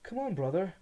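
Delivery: high, loud coaxing plea; weak smiling plea. A narrator's reading. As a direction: coax him with a weak smile. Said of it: "tried a weak smile".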